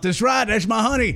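high-pitched hillbilly sidekick voice. Retold or imitated. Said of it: "redneck Kentuckian".

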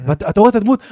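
ordinary delivery

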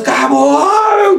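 high, stretched mock yell; re-enacted victory shout. Player retelling his outburst to teammates, high mock shout. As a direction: shout it high, as if the match just ended. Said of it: "eu nunca falo assim".

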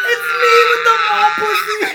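high, very loud laughing wail; hammed-up cry of shame; mock wail. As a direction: wail it loudly through laughter.

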